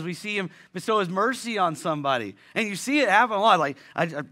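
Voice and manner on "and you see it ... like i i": ordinary delivery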